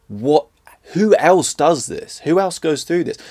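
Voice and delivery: loud, pleading disbelief; exasperated outcry. Retold or imitated. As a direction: throw the question out in despair. Retold despair at fourteen-hour attack.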